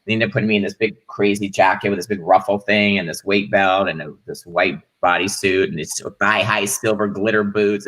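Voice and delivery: ordinary talk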